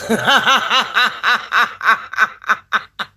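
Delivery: long, rapid run of ha-ha laughter; laughing. Real, not acted. Storyteller laughs after promising a shout-out, long giggling cackle. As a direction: laugh it out in a long staccato string.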